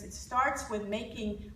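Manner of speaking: normal talking voice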